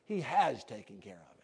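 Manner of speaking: low, hushed, tender assurance; loving. Real, not acted. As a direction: say it gently and lovingly, voice dropped low. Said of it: "as lovingly as I know how to say".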